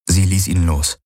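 ordinary delivery, nothing marked

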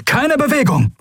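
high, sharp call; called-out command. A narrator's reading. Pirate surprises whoever is aboard, called-out command. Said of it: "rief er".

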